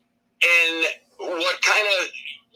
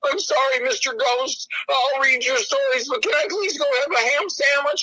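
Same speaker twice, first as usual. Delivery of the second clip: high, wheedling little plea; hammed-up sheepish request; meek pleading character; 8 semitones higher than usual. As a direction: beg permission like a timid servant. His character begging the ghost.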